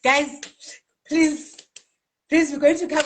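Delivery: giddy please to the group; excited plea. Real, not acted. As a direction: beg them to come, all giddy. Pleading with viewers.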